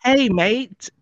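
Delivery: high-pitched British "Hey, mate"; British accent. Shown as a demonstration. Demonstrating British against Australian for friends, high British accent. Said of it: "That's British, right there".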